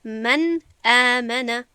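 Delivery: slow, loud من آمن with a distinct n before the hamza; clear, full nun. Shown as a demonstration. Teacher gives the hamza example, izhar in من آمن. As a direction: recite من آمن, n fully sounded, then a clean glottal onset.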